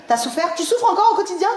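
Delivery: ordinary talk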